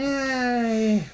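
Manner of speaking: cartoon cheer. Cheers after the jingle.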